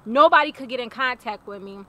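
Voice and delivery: normal talking voice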